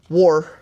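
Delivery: "War." said as one syllable, no t; nephew's way of saying water. Retold or imitated. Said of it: "That's what my nephew calls it".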